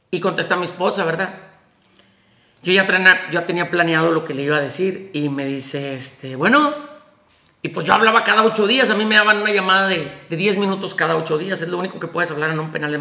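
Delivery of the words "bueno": higher-pitched rising phone greeting; retold telephone hello, lifting at the end; answering the phone. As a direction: say it as a questioning pickup. Wife answers the phone in retelling.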